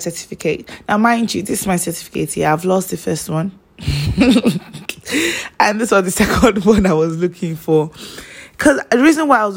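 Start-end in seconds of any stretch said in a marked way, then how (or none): 3.8-5.6 a high laugh, amused at herself; embarrassed laugh. Real, not acted. A young woman laughing to viewers about the lost certificate, high laugh. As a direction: laugh it off, a bit sheepish.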